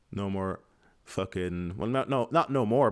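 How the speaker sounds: ordinary talk, nothing marked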